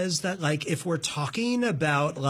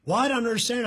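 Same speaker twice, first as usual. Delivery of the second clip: assistant voice; 6 semitones higher, much faster than usual. Retold or imitated. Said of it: "it's constantly like".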